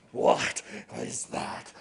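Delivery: a startled ghost hissing the question; low spirit whisper. Acted. Snow woman hears the babies.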